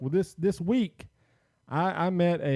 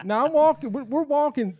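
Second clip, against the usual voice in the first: excited; 12 semitones higher, 5 dB louder than usual. Real, not acted. Excited retelling of walking.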